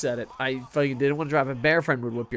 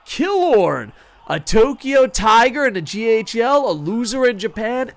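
excited gush; 8 semitones higher, 6 dB louder than usual. Real, not acted. Excited about favorite player.

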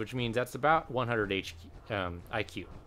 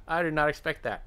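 laughing surprise; 6 semitones higher, 5 dB louder than usual. Real, not acted.

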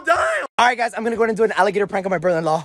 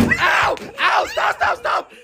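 much higher shouts of pain; pained yelling; much slower than usual. Real, not acted. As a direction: yelp to make her stop. Mom hitting him.

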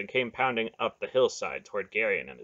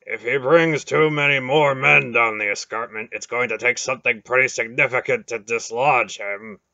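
louder, rumbling warrior voice; 3 semitones higher, 8 dB louder than usual. A narrator's reading. Beric scowling down at the Murgos, rumbling. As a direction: rumble it out like a big bearded lord. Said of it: "Beric rumbled".

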